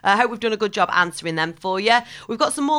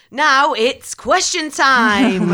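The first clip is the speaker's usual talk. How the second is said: slow, loud, higher game show announcer; 7 semitones higher, 8 dB louder, much slower than usual. Acted. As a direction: build it up like a quiz host. Host announces quiz segment.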